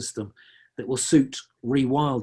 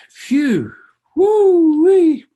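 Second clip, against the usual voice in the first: high, loud whooping sigh of relief; a giddy phew and a loud high woo-wee; relieved whoop; 11 dB louder, much slower than usual. Real, not acted. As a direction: blow out then whoop with real relief. Relief at nearly finishing talk.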